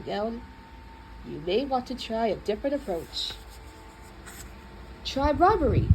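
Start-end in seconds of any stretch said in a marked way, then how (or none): none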